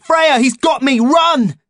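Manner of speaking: very high, loud call; desperate warning shout. A narrator's reading. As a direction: yell her name as loud as you can. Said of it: "I shouted as loudly as I could".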